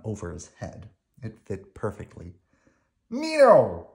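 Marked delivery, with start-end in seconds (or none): 3.1-3.9 excited exclamation, high and loud; cheery high burst; Bert. A narrator's reading. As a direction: pitch leaps up on a loud, delighted cry. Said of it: "he said".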